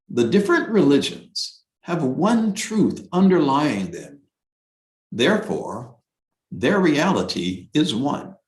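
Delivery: lifted scripture-reading voice; formal reading. Acted. Teacher quoting Abdul Baha to listeners, formal reading. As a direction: read it as a sacred text. Reads quoted passage aloud.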